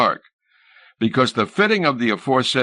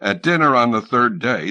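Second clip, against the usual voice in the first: plain reading voice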